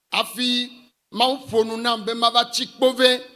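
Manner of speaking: ordinary talk, nothing marked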